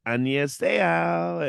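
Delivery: slow, high, sing-song Korean greeting Annyeonghaseyo; overdone greeting. Retold or imitated. Trying Korean greeting at a friend's bar, slow high sing-song.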